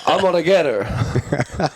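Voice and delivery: slow, drawn-out confident boast; cocky vow. Retold or imitated. Drunk fighter at after party eyeing ring girl, slow cocky vow. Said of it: "I'm like".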